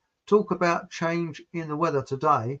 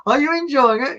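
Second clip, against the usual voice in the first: higher, louder chirpy asking; bright high question; 10 semitones higher, 7 dB louder than usual. Retold or imitated. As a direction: chirp it like a cheery friend. Friend asking, retold.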